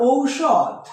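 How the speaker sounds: slow, stretched ঔষধ; example word modelled. Shown as a demonstration. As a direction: open ঔষধ on a long "ou" glide. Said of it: "যদি বলি আমরা".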